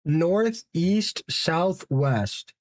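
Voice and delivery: each word stretched slowly; slow drawn out. Shown as a demonstration. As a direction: draw out every word.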